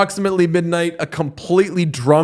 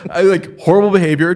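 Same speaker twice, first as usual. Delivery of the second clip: usual speaking voice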